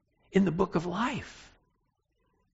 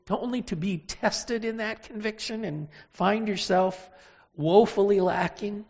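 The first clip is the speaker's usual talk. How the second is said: normal talking voice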